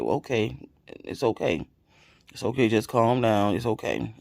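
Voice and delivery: a hint of husband calming her down gently; soothing husband. Husband on the phone calming his crying wife, softly reassuring.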